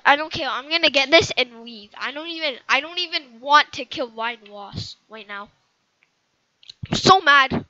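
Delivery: loud, fed-up grumbling; angry rant. Real, not acted. Gamer venting loudly about the game. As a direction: vent it out loud.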